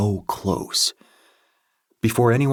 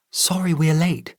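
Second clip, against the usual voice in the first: put-on young woman voice; 9 semitones higher than usual. A narrator's reading. The sound of her voice.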